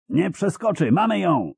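high, exultant cry; triumphant high-pitched shouting; triumphant yell. A narrator's reading. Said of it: "wrzasnął triumfalnie Mun".

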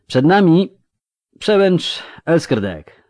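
steady now, finish the speech. A narrator's reading. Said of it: "dokończył pewniejszym już głosem". (Boreasz kończy pewniejszym głosem, głośno)

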